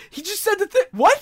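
high-pitched, loud mock disbelief; imitated flabbergasted outcry; incredulous Bernie Sanders. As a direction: act out an exasperated outburst, jumping up in pitch. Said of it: "And Bernie's like".

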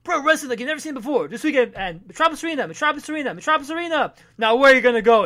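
high hype announcer voice. Retold or imitated. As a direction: pump up the crowd. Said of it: "it's a wrestling ad".

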